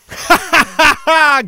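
high, loud cackle at own pun; loud laugh. Real, not acted. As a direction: crack up at your own joke.

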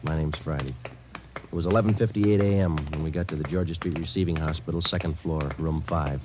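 narration. Acted. Detective narrates case opening.

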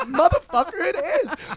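loud, high indignant comeback; mock angry. Insisting to drunk friend it is his room, mock angry. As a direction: act out snapping back in a silly quarrel.

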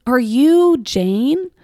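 loud, high, stretched-out question; imitated eager stranger. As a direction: mimic a stranger's emphatic query, drawing it out. Stranger accosting her, acted out.

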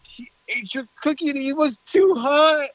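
high, crying imitation of the brother; mock crying brother. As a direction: whimper it out like the panicked brother. Brother breaks bad news.

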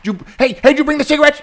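high, rowdy jobsite bro voice; hyped-up morning dude. Acted. Comedian acting a dude raring to go at six a.m., hyped and high. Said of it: "there's, like, the dude who's".

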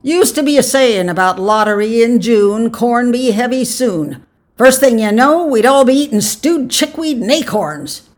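put-on high, loud cranky old-timer; grumbling old man. A narrator's reading. Old man scoffs at giving up the lottery, loud grumbling. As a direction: rant like a crotchety village elder.

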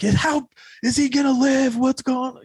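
high, panicked questioning; freaking out. Retold or imitated. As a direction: reenact frantic pleading for answers. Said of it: "I'm just freaking out".

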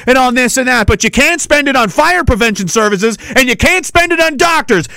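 angry rant. Real, not acted. Host raging at the premier over spending, angry shout. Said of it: "Why is he so angry?".

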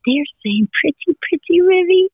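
put-on high singsong to a toddler; singsong bird words. Grandmother telling granddaughter the birds' song, high singsong. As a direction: coo it like the birds are singing to her.

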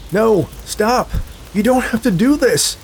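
high, loud, panicked begging; desperate pleas. A narrator's reading. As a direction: beg for your life. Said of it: "But his pleas were swallowed".

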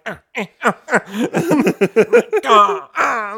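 high grunting mock struggle; in a headlock. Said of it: "with this guy in a headlock being like".

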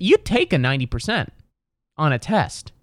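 ordinary talk

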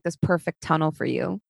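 usual speaking voice

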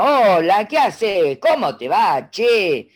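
loud, bright Argentine greeting as a mother; mamá argentina con acento marcado; Argentine mom. Pretend. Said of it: "en argentino".

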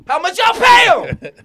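high, loud shouted question. Real, not acted. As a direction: yell it across the room. Shouting at the crew.

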